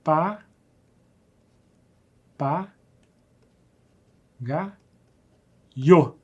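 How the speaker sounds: "Pa, pa, ga, io" spoken one syllable at a time with long pauses. Shown as a demonstration. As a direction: split "papagaio" into separate beats. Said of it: "Vamos separar a sílaba aqui".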